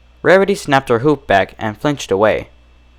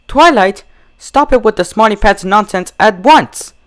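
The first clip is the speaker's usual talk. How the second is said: high, sharp demand; firm scolding; 10 semitones higher, 3 dB louder than usual. Acted. Rarity scolding Twilight about Smarty Pants. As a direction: command her to stop.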